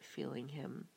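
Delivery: ordinary delivery, nothing marked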